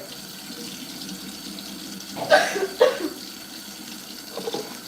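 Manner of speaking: long fit of dry coughs. Real, not acted. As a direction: keep coughing, throat itching. Why my throat is itching.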